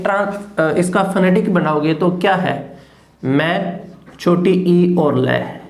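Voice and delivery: ordinary talk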